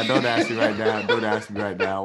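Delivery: laughing while fending off the question; laughing protest. Real, not acted. Put on the spot, laughing.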